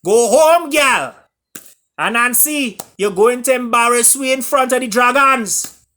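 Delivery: high, loud mocking taunts in Caribbean voice; jeering chorus. A narrator's reading. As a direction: jeer at her loudly and high like a rowdy crowd. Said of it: "chorus chimed in with some choice words".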